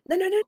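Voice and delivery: high, emphatic no no no. Real, not acted. Teacher rejecting the dismissive approach, emphatic. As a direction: shoot the idea down firmly.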